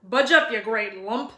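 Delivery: lightly voiced booming giant, friendly; a big friendly giant asking for tea; gruff giant. A narrator's reading. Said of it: "said the stranger".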